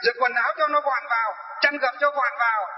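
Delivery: mock scolding, pitched high and nagging; nagging roommate. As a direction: nag about towels and slippers like a fussy old woman.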